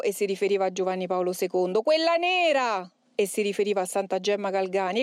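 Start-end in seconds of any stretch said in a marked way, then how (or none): 1.8-2.9 high, slow demonic cry; demon exclaiming. A narrator's reading. Demon pointing out a kneeling saint, high cry. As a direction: call it out as an agitated demon. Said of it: "il demonio esclamò".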